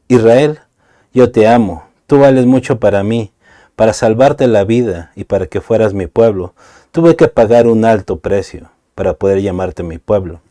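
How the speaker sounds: loud, ringing Bible reading; fervent public reading of scripture; proclaiming scripture. Acted. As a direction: read the verses out like a preacher.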